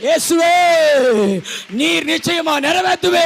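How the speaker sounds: high, loud, driving sermon cadence; full-force prophetic preaching. Real, not acted. Preacher prophesying to resurrection-service congregation, full-force preaching. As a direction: proclaim it loudly, building to a shouted climax.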